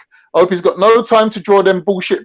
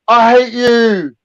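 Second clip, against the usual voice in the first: mock tantrum; 4 semitones higher, 3 dB louder, slower than usual. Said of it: "he started spazzing out".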